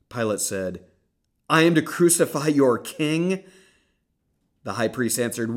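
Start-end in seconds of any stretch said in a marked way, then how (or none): 1.5-3.4 loud, much higher disbelief; incredulous question. Acted. Pilate questioning the crowd, loud incredulity.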